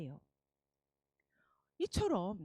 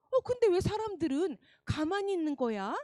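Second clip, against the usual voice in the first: ordinary delivery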